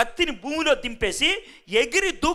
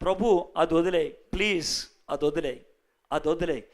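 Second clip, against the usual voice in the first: humble plea; 8 semitones lower, 6 dB quieter than usual. Retold or imitated. Voicing a plea to God.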